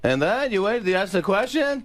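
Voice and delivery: high, building Seinfeld yell; Seinfeld impression. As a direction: climb up into a Seinfeld shout. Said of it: "three different Jerrys".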